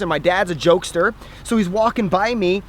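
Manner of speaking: normal talking voice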